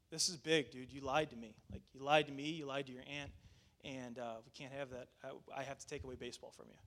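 low, soft, serious reprimand; subdued stern parent; quiet heart-to-heart. Retold or imitated. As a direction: lay down the punishment quietly. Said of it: "having this heart-to-heart with him".

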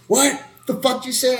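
high-pitched imitation of a confrontational neighbor; mock outraged challenge. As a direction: squawk it back as the neighbor. Neighbor confronts him, acted out.

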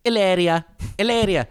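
Hilaria with no H and a tapped r, each time; Spanish pronunciation. Shown as a demonstration. Podcaster repeats Hilaria the Spanish way, no H. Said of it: "She says Hilaria".

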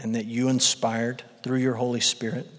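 soft, reverent opening prayer. Acted. Pastor praying over his church before the sermon, soft and reverent. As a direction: pray quietly over the congregation.

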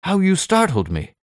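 very high, startled exclamation; startled cry. A narrator's reading. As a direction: cry out as if just given a fright. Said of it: "I cried".

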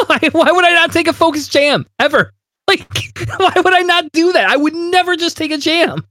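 high squeaky voice, breaking into laughs; exasperated falsetto rant with giggles; incredulous outburst. Real, not acted. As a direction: protest in a comically high, disbelieving pitch. Mock-incredulous outburst over the card.

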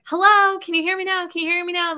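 straining to be heard on the phone; calling into phone. Retold or imitated. Wife on bad phone line to campground, calling out.

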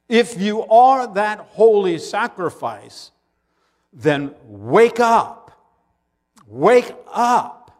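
loud, high fervent sermon; full-force preaching. Real, not acted. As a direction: preach it with fire.

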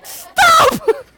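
put-on high crying kid shouting stop; soaked toddler wailing. Father imitating his drenched son wailing at the whale, high and crying. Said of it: "Trevor, rather, is going".

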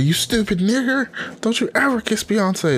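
high-pitched mock outrage at the kisser; mock furious fan. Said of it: "They're like".

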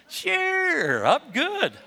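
high, laughing fake assurance; a bad liar's squeaky yes; lying voice. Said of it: "That's how you know somebody's lying".

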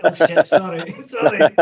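loud, laughing sorry through the giggles; laughing apology. Retold or imitated. Barman apologising to a rough local, laughing loudly. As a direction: blurt out sorry and laugh at yourself. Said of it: "I'm like".

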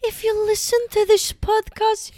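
put-on high, loud silly old-person voice; badly faked nonna, high and loud; failed Italian nonna. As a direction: botch an Italian nonna impression. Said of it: "um, Italian nonna?".

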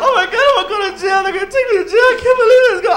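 high, loud, fast panic; freaking out. Retold or imitated. Driver panicking about going to jail, high, loud and fast. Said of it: "I start freaking out, talking out loud".

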